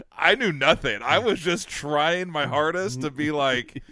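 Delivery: playing the shocked innocent for the guard; worked-up retelling. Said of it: "And I go".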